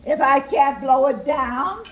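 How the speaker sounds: loud, punched-out wolf threat; threatening wolf. A narrator's reading. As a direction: boom out each threat with a pause between. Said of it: "Wolf said".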